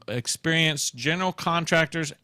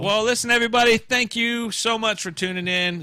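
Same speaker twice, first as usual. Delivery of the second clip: louder, higher show-host voice; host sign-off; 9 semitones higher, 7 dB louder than usual. Acted. Host signing off the episode to listeners in a bright announcer voice.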